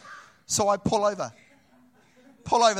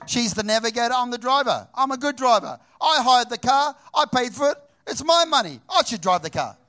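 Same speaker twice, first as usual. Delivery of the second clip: higher and louder, building up; mock indignant rant; 6 semitones higher, 5 dB louder than usual. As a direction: huff over who drives the car. Offended man's inner rant.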